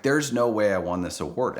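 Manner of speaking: usual speaking voice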